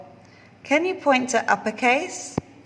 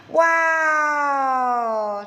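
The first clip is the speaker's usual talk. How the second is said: excited wow; 5 semitones higher than usual. Acted. Teacher reveals letter excitedly.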